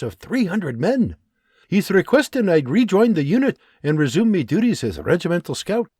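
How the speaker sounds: lightly voiced excited soldier; eager Scottish sergeant. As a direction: rush through the good news. John reads letter.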